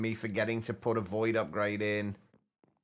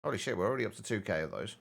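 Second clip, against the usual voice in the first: usual speaking voice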